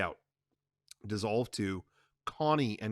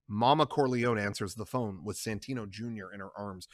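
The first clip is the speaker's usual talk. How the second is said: usual speaking voice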